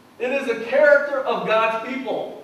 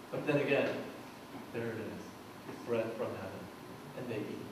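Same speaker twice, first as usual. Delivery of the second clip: hushed; 11 semitones lower, 14 dB quieter, faster than usual. Acted. Hushed reveal of morning bread.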